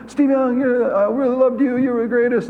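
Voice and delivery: high, gushing fan voice; excited fan. Retold or imitated. Airport fan gushing to the quarterback, high and eager. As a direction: gush like a starstruck fan. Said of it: "And he said".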